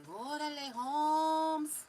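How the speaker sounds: put-on a quick Chicano slang accent, rising loud on Holmes. Tarot reader jokes to viewers in Chicano accent. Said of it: "I just now said that in that kind of accent".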